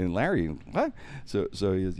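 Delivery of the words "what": high, rising mock astonishment; incredulous. Friends hearing about the monkey fight, acted incredulity. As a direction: squeak it out in retold disbelief.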